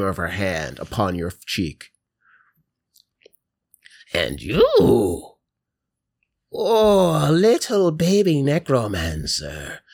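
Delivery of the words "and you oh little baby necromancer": put-on high, cooing old woman; old lady grandma. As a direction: fuss slowly over a sweet little baby. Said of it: "in an old lady's".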